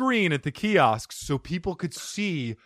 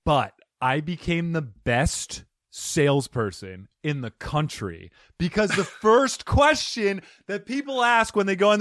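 usual speaking voice

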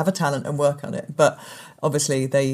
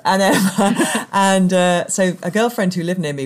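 ordinary talk

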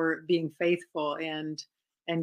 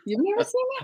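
laughing; faster than usual. Real, not acted. Amused disbelief at his answer.

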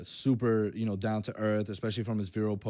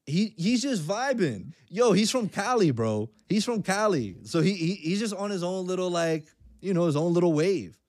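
excited; 7 semitones higher than usual. Real, not acted. Hyped praise of the guest.

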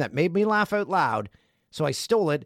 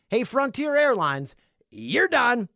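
higher, punchy "you're done"; catchphrase call-out; 9 semitones higher, 4 dB louder, slower than usual. Acted. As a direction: call out the airline with relish. Listener letter's catchphrase.

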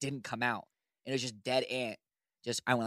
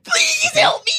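high, loud mock panicked shout; a hammed-up cry for help; 11 dB louder, slower than usual. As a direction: ham up a terrified plea for help. Teasing friend's imagined panic.

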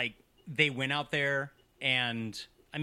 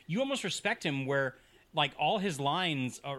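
ordinary talk

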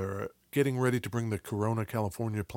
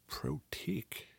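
whispered; 3 semitones higher, 12 dB quieter, slower than usual. Shown as a demonstration. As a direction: whisper Protique with no voice at all. Repeating the brand name.

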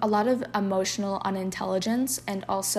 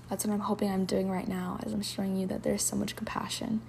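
ordinary talk, nothing marked

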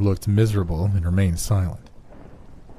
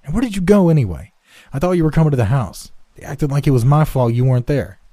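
put-on loud, high, fast boyish complaint; heated quarrelling youngster; aggrieved; 7 semitones higher, 5 dB louder than usual. A narrator's reading. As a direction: pile on the accusations rapidly. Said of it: "Red had not used up his grievances".

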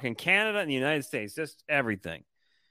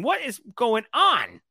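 ordinary talk, nothing marked

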